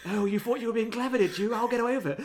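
high, loud mock menace; a judge suddenly turning sinister, hammed up; hammed-up sudden villain. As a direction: sneer it like a cartoon villain turning on her. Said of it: "just turns and is like".

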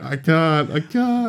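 high, whiny hesitation; mock dithering. Imitating himself unable to buy the game, whining high. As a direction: fret like you can't decide. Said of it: "been like".

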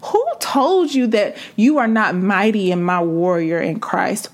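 ordinary delivery

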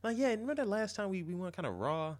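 high sleazy guy voice. Pretend. Voicing a guy.

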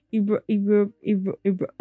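stuck repeating "ero-", never finishing it; stumbling over word. Real, not acted. Narrator retrying a word they can't say, stumbling on "ero-". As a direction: keep restarting "ero-" and breaking off. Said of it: "why can't I say this word".

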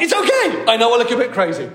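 high, loud and eager comforting; Jesus reassuring a terrified John, high and loud; excited reassurance. Retold or imitated. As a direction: reassure a frightened friend with huge enthusiasm. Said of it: "Jesus is like".

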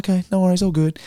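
high, breezy mock reassurance; forced casual. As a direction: toss it off high and light. Brother playing along, forced casual.

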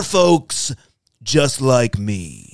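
loud Trump sign-off, slowing down; hammed-up, drawn-out Trump voice; Donald Trump impression. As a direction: wrap up boastfully like Trump. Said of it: "best Donald Trump impression".

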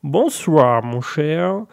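slow, put-on English aristocrat accent; My Lord. English lord greets the host, slow put-on English accent. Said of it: "Bonsoir, My Lord".

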